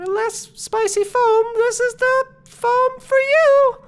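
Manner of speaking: very high, hesitant little creature voice; Flea's voice. Acted. Flea nervously sells mild foam to the crowd, very high voice. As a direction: squeak it up high and fumble the pitch. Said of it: "then Flea says".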